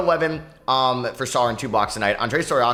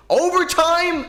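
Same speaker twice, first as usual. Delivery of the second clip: stunned overtime shout; 5 dB louder, much slower than usual. Real, not acted. Shock at game going to overtime.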